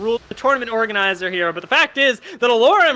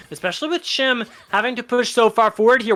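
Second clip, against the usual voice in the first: ordinary talk, nothing marked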